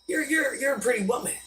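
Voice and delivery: a hint of a charming gentleman; mock real man. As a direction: play the polite admirer paying a compliment. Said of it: "A real man would say".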